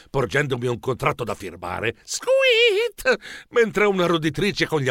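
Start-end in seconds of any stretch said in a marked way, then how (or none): 2.0-3.2 long, very high squeak; animal squeak. Acted. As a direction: let out a drawn-out little squeal. Verso improvviso di animale. (squittio acuto prolungato)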